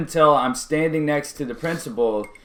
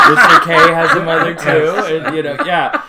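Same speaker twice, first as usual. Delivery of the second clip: laughing; 4 semitones higher, 10 dB louder than usual. Real, not acted. Laughing while retelling the speech.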